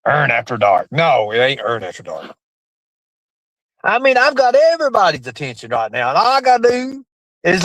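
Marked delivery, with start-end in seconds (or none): 0.1-2.4 late-night announcer. Pretend. Late-night show joke voice.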